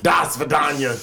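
Das Vidanya said the Russian way, heavy accent. Shown as a demonstration. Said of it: "like it was supposed".

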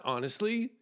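ordinary talk

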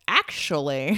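loud pedantic actually, rising at the end; know-it-all correction. Retold or imitated. Imitating someone about to correct her pronunciation, pedantic. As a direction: draw out a mock know-it-all interjection. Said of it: "someone's gonna be like".

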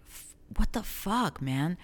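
retold gut-level disbelief; visceral reaction. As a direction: react from the gut, pitch jumping up. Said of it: "my visceral reaction was like".

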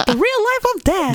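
very high, slow squeaky cartoon voice; Mickey Mouse voice. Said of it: "Sounded like Mickey Mouse there".

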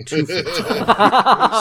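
squeaky, slowed, laughing mock of Roy's size; laughing high squeal. Player teasing the table about tiny Roy, laughing. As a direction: stretch out two foot tall through laughter, high.

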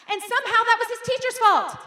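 loud, high indignant exaggeration; mock outrage. Pastor mocking an angry mom's email, high loud outrage.

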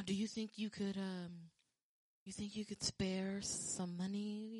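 mock pleading scammer. Scammer asking her for cash, slow mock wheedle. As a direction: beg for money, laying it on. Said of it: "And then that".

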